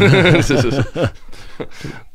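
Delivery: high burst of real laughter. Real, not acted. Joke about next week's episode.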